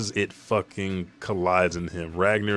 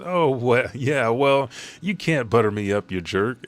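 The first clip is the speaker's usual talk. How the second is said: flustered retort; 4 semitones higher, 4 dB louder than usual. Acted. Chopper flustered, dancing on leg.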